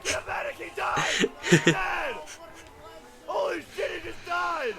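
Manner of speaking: panicked high shouting; man down shout. Real, not acted. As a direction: yell that he's down. Player dies on stream.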